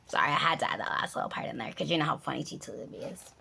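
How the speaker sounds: fast whispered aside; hurried, hushed apology. Real, not acted. Aside to viewer after gag.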